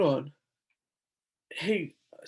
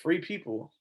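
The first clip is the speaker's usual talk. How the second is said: usual speaking voice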